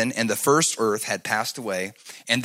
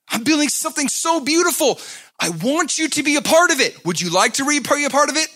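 higher, loud pleading preach; fervent invitation; 4 dB louder than usual. Acted. Preacher voices God inviting people, fervent. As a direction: invite them in, earnestly.

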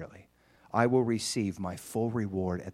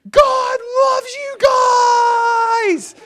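much higher, much louder, very slow and stretched; joyful shout; 13 dB louder, much slower than usual. Retold or imitated. Driver shouting to kids by the road, ecstatic yell. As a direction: call out the window with overflowing joy.

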